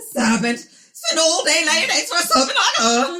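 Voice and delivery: high, loud theatrical wail; exaggerated stage-style outcry; hammed-up melodrama. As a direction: playfully declaim in mock horror. Continuing the theatrical serpent bit.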